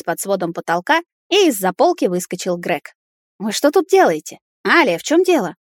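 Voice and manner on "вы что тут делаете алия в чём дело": higher, startled demand; alarmed question. Acted. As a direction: burst in asking what happened. Greg bursts in, alarmed.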